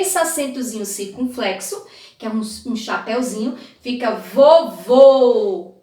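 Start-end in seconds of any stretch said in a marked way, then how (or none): 4.1-5.7 slow vovô with a closed final ô. Shown as a demonstration. Teacher explaining the circumflex: slow closed ô. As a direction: stretch vovô, keeping the last ô closed. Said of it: "um som fechado".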